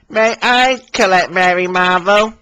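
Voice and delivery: high, eager little engine asking nicely; polite Billy. Acted. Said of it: "asked Billy politely".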